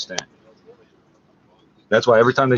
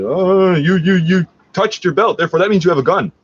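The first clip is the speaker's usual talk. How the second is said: higher and louder, a hammed-up accusing cop; mocking the cops; 8 semitones higher, 4 dB louder than usual. Cops rolling up on him, hammed-up accusing. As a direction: parody a jumpy cop making a wild claim. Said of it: "rolled up on me and said".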